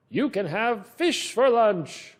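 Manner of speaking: pirate voice. Acted. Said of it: "different sounds in the character".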